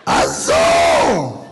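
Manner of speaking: immigrant mom shouting a name; mom calling out. Retold or imitated. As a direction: yell the name across the house. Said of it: "here's my mom".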